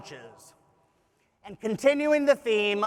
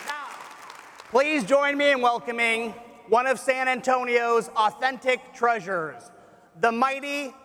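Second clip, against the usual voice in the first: announcer introduction; 3 semitones higher than usual. Real, not acted. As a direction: hype up the band's entrance. Introducing the band on stage.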